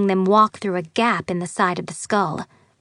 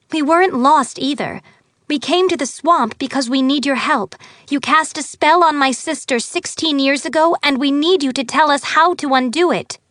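put-on higher, urgent young girl; earnest girl voice; 6 semitones higher, 4 dB louder than usual. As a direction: plead your case, breathless and eager. Girl pleads with the fairy.

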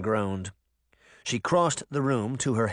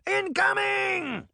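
much higher, slow alarmed yell of a cat soldier; shrill New Jersey war cry; startled shout; slower than usual. Acted. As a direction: bark a warning as if under fire. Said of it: "It shouted".

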